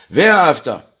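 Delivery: loud, declaimed opening word of the verse; verse recitation. Acted. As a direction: proclaim the first word of the prayer. (déclamé fort, comme une lecture liturgique)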